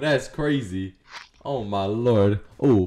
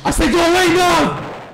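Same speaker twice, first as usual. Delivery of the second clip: higher, louder order to leave; real annoyed shout; angry yell; 12 semitones higher, 3 dB louder than usual. Real, not acted. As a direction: snap the command at her. Yelling at a teammate.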